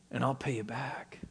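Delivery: very soft, whispered aside as the slave; hushed and voiceless; whispered promise. Acted. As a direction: whisper the servant's hopeless promise.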